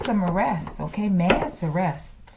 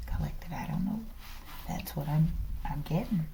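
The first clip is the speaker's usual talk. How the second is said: whisper; 3 semitones lower, 8 dB quieter than usual. Real, not acted. Unsure reading aside.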